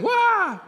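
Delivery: high, loud mock wail; exaggerated kid's fake bawl; fake scream. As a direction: let out a hammed-up, very high pretend cry of pain. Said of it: "he'd slap the belt and I'd scream".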